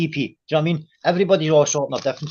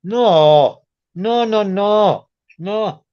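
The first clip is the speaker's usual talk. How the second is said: drawn-out protest; 6 semitones higher, much slower than usual. Real, not acted. Protesting he did nothing wrong.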